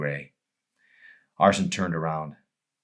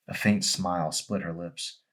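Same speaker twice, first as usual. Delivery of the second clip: ordinary delivery, nothing marked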